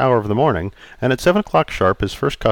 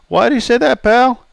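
put-on raised, jovial old customer; 14 semitones higher, 5 dB louder than usual. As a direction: play Charlie, teasing.

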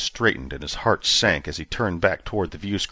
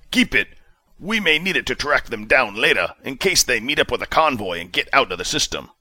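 clipped commanding officer tone; hint of a stern commander demanding answers; commander Justice, lightly voiced; 5 semitones higher than usual. A narrator's reading. Commander orders.

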